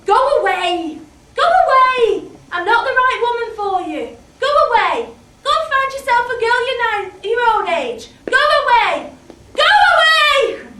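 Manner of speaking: high, loud, desperate shouts; screaming at the boy to leave; shouting go away. Acted.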